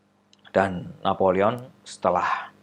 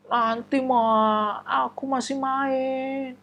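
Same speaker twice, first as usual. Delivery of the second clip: put-on child's whiny voice; 14 semitones higher, much slower than usual. Child reluctant to bathe.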